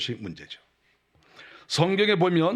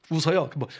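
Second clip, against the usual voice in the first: usual speaking voice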